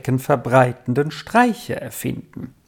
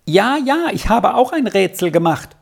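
high, eager interjection; keen child cutting into the talk; butting in; 6 semitones higher than usual. A narrator's reading. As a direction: jump in eagerly with a lifted pitch. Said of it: "fiel Hunne dazwischen".

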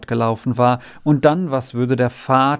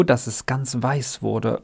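plain reading voice

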